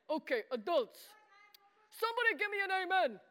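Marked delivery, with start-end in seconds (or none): 1.9-3.2 fast, higher call for a response; quick, pitched-up revival-style prompt; rallying call. Shown as a demonstration. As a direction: fire it at the crowd to rouse them. Repeats the call to the adults.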